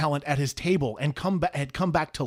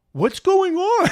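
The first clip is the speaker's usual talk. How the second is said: innocent surprise; 6 dB louder, slower than usual. Retold or imitated. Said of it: "and I'm like".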